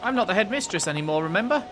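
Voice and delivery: lightly voiced former headmistress. Voices former headmistress in game dialogue.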